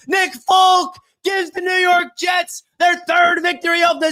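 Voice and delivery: excited, high, shouting play-by-play call; live play-by-play. Acted. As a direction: call the winning kick like a live broadcaster, yelling. Live call of winning kick.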